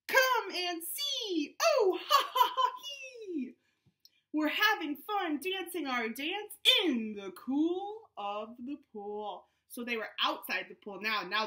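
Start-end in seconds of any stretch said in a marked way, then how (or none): 0.1-3.6 high slow call into laughter; laughing invitation. Acted. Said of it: "they all cried". 4.3-9.5 animated read-aloud to a Zoom class; reading to children. Acted. Reads picture book aloud to kids.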